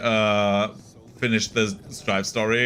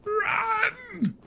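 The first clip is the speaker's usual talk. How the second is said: very high, soft drawn-out squeal; high little mock cry of alarm; falsetto cry; 4 dB quieter than usual. Mock cry during game play.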